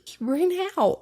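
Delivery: usual speaking voice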